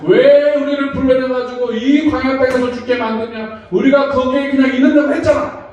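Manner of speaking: high-pitched grumbling protest; acted resentful complaint. Israelites blaming Moses at the sea, acted complaining. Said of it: "하면서 또 원망, 불평을 합니다".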